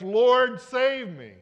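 loud, high plea for rescue; desperate cry. Retold or imitated. Peter in the waves calling to Jesus, loud desperate cry. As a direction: cry out like a sinking man. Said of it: "It was just".

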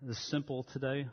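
soft, reverent pastor's prayer; hushed, earnest pulpit prayer; opening prayer. Acted. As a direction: pray quietly over the congregation. Opening prayer before the sermon.

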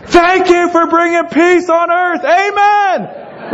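high, strained mock-shouted grace; yelled prayer. Dad at the dinner table so other kids can hear, yelling prayer. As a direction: holler each line of the blessing over a screaming kid. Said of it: "you are yelling your prayer".

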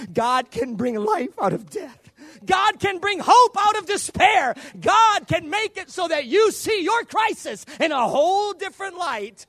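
slow, building from strong to a full high shout; preaching at full force. Acted. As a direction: hammer each line harder until it rings out. Preaching climax rising to shout.

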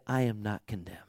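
hushed, breathy whisper; a soft whisper of "I am not condemned"; whispered verdict. Acted. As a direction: whisper it like a secret truth. Quoting the judge's verdict.

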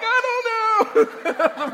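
slow, high, laughing panic; panicked reply. Retold or imitated. Caught boy answers his yelling dad, slow panicked laugh. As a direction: stammer it out like a caught kid. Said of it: "And I'm like".

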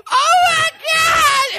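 very high, loud, drawn-out shriek of a scared date; hammed-up horror-movie squeal; mock terrified scream. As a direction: scream like a girl clinging in the theater. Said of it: "they're just like".

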